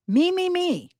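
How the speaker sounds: high, mocking chant; mocking self-absorption. YouTuber ridiculing a self-centred mother, in a high mocking voice. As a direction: pitch it up to ridicule a self-obsessed person.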